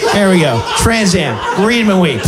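a hint of a proud clueless player; clueless Scrabble player. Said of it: "person who doesn't understand the rules of Scrabble".

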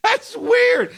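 very high-pitched disbelief; incredulous. Real, not acted. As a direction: squeak it out in disbelief. Reacts to preposterous ban.

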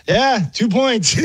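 high, drawn-out mock referee call; oblivious refs' call. Referees awarding the score on a trick play, oblivious mock call.

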